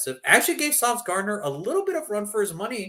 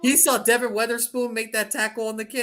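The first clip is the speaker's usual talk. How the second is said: usual speaking voice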